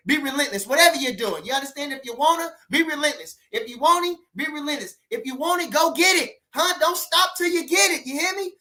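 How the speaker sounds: rally the crowd and build to a peak; fired-up pep talk. Real, not acted. Urging the chat to go get it, building hype. Motivating viewers to be relentless.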